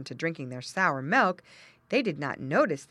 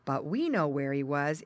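ordinary delivery

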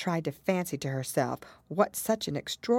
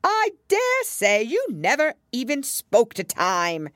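loud, high, drawn-out scorn; contemptuous; 9 semitones higher, 8 dB louder, slower than usual. A narrator's reading. Hatter scorning Alice for not understanding, contemptuous. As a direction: dismiss her grandly, stretching the words. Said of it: "tossing his head contemptuously".

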